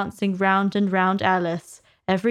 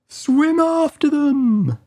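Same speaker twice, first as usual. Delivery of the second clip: high, louder slow scream; 8 semitones higher, 6 dB louder, slower than usual. Acted. Griffin screams the next dance step to Alice. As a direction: shriek it out wildly. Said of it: "screamed the Griffin".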